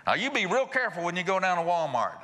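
high-pitched, fretful nagging of a spouse; anxious warning. Acted. Husband warning his wife about a Walmart trip, mock anxious.

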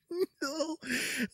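high, soft, squeaky laugh; giddy giggle. Real, not acted. Collector still reeling from the pull, giddy giggle. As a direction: let out a small high-pitched giggle.

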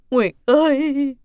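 loud, drawn-out tearful call; slow, high, weeping cry. Acted. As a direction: wail the name on seeing family arrive. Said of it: "Thấy Nguyệt, bà khóc".

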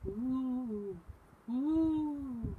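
long high hooted wind noise; drawn-out "ooh" of wind in trees; wind howl. A narrator's reading. Reader voices night wind in the trees, wind howl. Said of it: "The wind blew in the trees".

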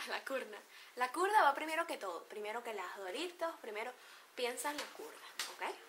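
usual speaking voice

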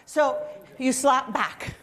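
ordinary delivery

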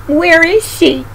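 a touch of storybook crying; mild mock crying. A narrator's reading. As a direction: ask in a high, lightly tearful voice. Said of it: "She began to cry".

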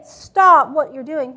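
stern teacher snapping at a student; sharp shutdown command. Shown as a demonstration. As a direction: bark it at the student. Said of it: "Once I say".

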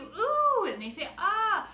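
rally the crowd with a loud ooh; loud call-out ooh. Shown as a demonstration. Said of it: "I can't hear you".

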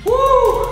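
high whoop of celebration; excited woo. Real, not acted. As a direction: cheer it out high and loud. Celebrating end of set.

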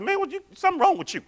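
impatient angel with attitude; exasperated. Retold or imitated. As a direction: rattle off the scolding. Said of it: "I said".

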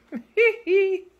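high, loud burst of laughter; a sudden delighted laugh. Real, not acted. Laughing at her dog lounging by the pool, delighted laugh.

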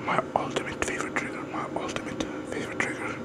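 quiet unvoiced ASMR whisper. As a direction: whisper right into the mic.

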